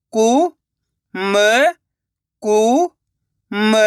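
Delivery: रु-का-व-ट spoken one slow drawn-out syllable at a time. Shown as a demonstration. Teacher sounding out a word for children, syllable by syllable. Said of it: "teacher sounding out syllables".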